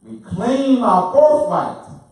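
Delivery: loud, slow, high-pitched declaration; rallying cry. Real, not acted. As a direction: cry it out slowly like a rallying call. Rallying the group at full force.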